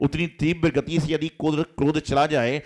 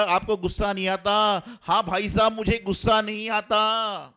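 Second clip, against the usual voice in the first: rising irritation; 8 semitones higher, 4 dB louder than usual. Retold or imitated. Repeated provocation, rising irritation.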